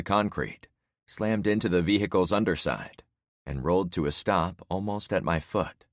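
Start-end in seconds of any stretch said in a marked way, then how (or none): none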